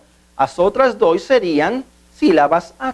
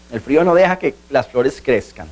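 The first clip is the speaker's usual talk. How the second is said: lower-pitched Spanish translation; 4 semitones lower than usual. Shown as a demonstration. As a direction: give the example in Spanish. Example repeated in Spanish translation.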